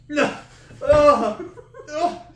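sour cry out; eating sour candy. Real, not acted. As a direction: wince at the sour taste. Sour reaction.

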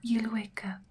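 ordinary delivery

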